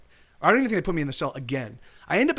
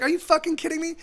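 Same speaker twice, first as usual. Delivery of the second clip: pitched much higher, incredulous; can't believe this is happening; disbelieving outrage; 11 semitones higher than usual. Retold or imitated. Recaptured by the same gang.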